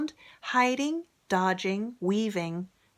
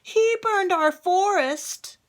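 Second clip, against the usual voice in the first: much higher and louder, slow and accusing; king crying out; 13 semitones higher, 7 dB louder, slower than usual. Acted. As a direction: blame the dragon loudly.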